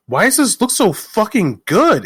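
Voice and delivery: high-pitched amazed disbelief; acted-out astonished reaction; blown away. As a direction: marvel at it, voice shooting up. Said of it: "we've just been kinda blown away by".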